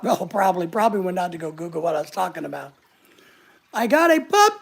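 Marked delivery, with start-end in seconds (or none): 3.7-4.6 high, loud squeal of news; excited announcement. Acted. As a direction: blurt out happy news for someone else. Reads out viewer's happy news.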